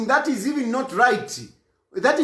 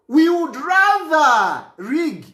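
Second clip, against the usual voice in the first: RATHER stretched out long and slow; emphatic retold stance; 3 semitones higher, 3 dB louder, much slower than usual. Explaining what the priest meant, emphatic. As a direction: lean hard on "rather", very slowly.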